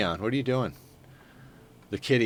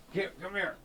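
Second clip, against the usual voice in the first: higher, much softer coaxing; coaxing the cat; 10 semitones higher, 11 dB quieter than usual. Real, not acted. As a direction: gently lure the kitty in.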